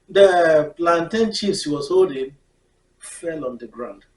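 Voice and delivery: ordinary talk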